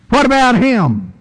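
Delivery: high, whiny what-about-him; jealous disciple. Acted. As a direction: sulk and point at the other guy. Peter's jealous question repeated.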